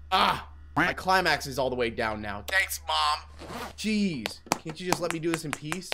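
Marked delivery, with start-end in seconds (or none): none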